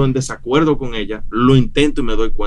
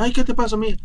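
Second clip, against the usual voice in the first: high-pitched mock motherly fussing; madre preocupada imitada; 10 semitones higher than usual. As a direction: imitate a worried mother cooing to her baby. Imita a la esposa consolando a la bebé. (mamá consentidora, voz aguda)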